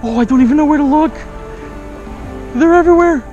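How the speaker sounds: ordinary talk, nothing marked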